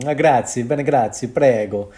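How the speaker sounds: loud, hammed-up Italian accent. Shown as a demonstration. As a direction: put on a punchy stage-Italian accent. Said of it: "I could be a bit more Italian".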